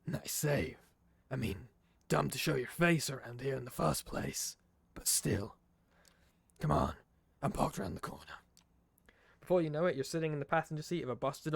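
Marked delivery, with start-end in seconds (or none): 0.1-8.3 lightly voiced gruff old detective; a hint of a cigar-smoking Nosferatu contact; gravelly D'Angelo. D'Angelo hauling the player into the alley, lightly gruff. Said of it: "the gravelly voice sure does".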